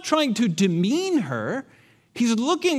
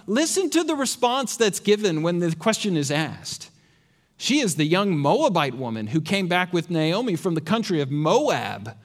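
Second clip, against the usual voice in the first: ordinary delivery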